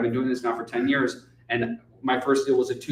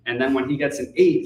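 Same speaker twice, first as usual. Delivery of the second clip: ordinary talk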